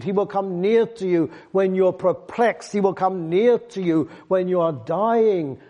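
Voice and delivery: rising preacher's fervour, hammering the promises; fervent preaching. Acted. Preaching builds to full force.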